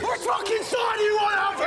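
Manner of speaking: high and loud furious yell; angry shout. Acted. Tommy yells at Alfie in a heated row, furious. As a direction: shout him down.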